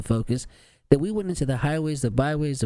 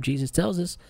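ordinary talk

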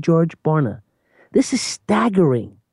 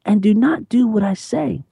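Jesus asking; 6 semitones higher than usual. Acted. Jesus's question voiced by preacher.

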